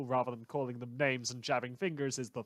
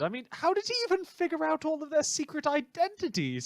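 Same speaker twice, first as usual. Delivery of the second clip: much higher, gushing fan voice; mock admiration; 3 dB louder than usual. Radio host gushing to listeners about the vigilante Gavel, high mock awe. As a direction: swoon over him like a starstruck fan.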